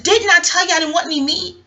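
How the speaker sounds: high, indignant telling-off; retold scolding. Woman who refused meat scolding her family, indignant.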